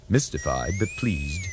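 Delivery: ordinary delivery, nothing marked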